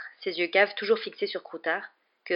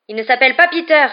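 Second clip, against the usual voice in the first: high, loud outraged shout; indignant full-voiced cry; yelled; 9 semitones higher, 12 dB louder than usual. A narrator's reading. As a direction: yell the correction, climbing higher. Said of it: "hurla Ron".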